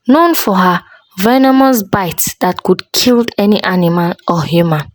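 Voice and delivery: usual speaking voice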